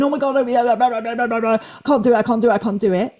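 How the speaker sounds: racing thoughts. Acted. Coach voicing a runner's racing thoughts, mock panic. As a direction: run the words together like a mind spinning out. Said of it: "If our thoughts are racing".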